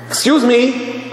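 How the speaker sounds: high, drawn-out mock reproach; acted scolding interruption; indignant objection. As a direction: cut in, stretching it out high. Acted professor objecting to noise.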